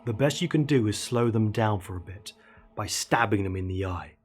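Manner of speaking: ordinary delivery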